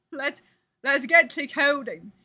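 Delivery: badly faked Aussie impression; Coyle's Australian accent. Streamer imitating Coyle starting work, hammed-up Australian accent. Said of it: "Every time I try to sound fucking, uh, Australian".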